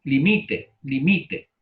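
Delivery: "limite" said li-MI-te, stress on MI; stress on the middle syllable. Shown as a demonstration. Teacher giving the other pronunciation, "limite" stressed on MI. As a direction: lean on MI in "limite". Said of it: "Palabra llana con pronunciación en la".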